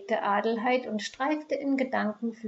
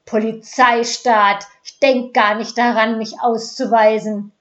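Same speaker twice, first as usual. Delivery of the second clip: put-on young man shouting at the officer; indignant refusal; 12 dB louder than usual. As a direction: refuse it loudly in defiance. Stubborn young man refuses police.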